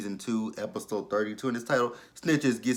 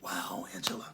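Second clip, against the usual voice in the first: whispered wow; 2 semitones lower, 8 dB quieter than usual. Retold or imitated. Vlogger reacting to Angela in a whisper. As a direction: breathe it out in dry disbelief. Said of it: "And I'm just like".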